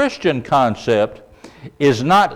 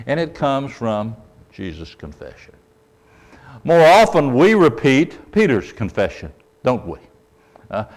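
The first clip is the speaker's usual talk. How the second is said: ordinary talk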